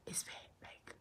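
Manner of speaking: whispered aside; whispered under the breath. Real, not acted. Aside about cost.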